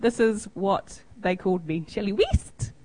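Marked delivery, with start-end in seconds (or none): 1.9-2.7 New Zealand accent, vowels flattened; New Zealand accent as heard. Shown as a demonstration. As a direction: say Shelley West as a Kiwi would. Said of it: "This is how they thought I said my name".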